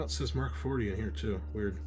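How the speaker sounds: ordinary delivery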